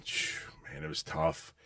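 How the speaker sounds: whispered exhale of relief; unvoiced sigh over hard days; breathy sigh. Real, not acted. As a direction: blow it out, remembering tough times. Recalling hard non-union work.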